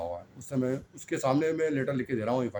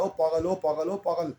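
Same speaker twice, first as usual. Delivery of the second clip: higher mock yell, jeering; retold taunting call; 6 semitones higher than usual. Preacher recalling the boy shouting at him in the street, mock yell. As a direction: call it out like a drunk lad. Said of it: "آواز لگا رہا ہے".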